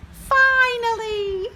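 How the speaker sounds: high, loud, slow cry of relief; joyful relief. Acted. Charlotte alone in space, loud high relieved cry. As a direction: let it all out at last.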